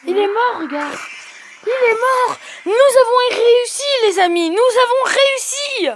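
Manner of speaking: high, loud, triumphant shouting; wild excitement. Real, not acted. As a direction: cheer like you just beat the boss. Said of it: "je pète des câbles quand je réussis". (crier de joie, surexcitée)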